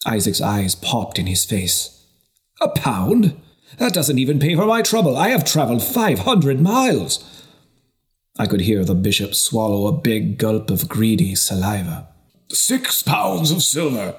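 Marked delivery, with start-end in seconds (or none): 2.6-7.2 whispered, high scoff; indignant merchant. Acted. Merchant scoffs at low offer. 12.5-14.1 greedy bishop raising his bid; eager bishop. Acted.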